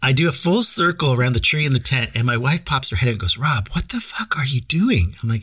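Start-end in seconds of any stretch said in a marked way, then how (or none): 3.4-5.1 slow, voiceless whisper; imitating wife's whisper. Wife whispering to her husband from the tent, slow whisper. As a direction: hiss it out slowly like a wife woken at night.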